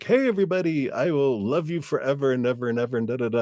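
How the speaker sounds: put-on high, fast childlike gushing; escaped heart babbling love in a high voice; gushing little child. Freed heart to everybody, high excited child voice. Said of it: "a young little French child".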